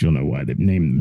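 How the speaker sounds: ordinary delivery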